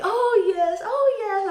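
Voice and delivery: high, over-eager fake agreement; pretending to understand. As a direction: play along loudly as if you follow. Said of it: "pretending like".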